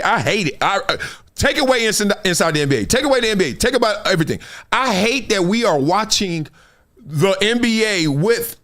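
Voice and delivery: ordinary talk, nothing marked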